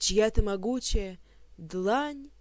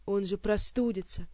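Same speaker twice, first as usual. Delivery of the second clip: usual speaking voice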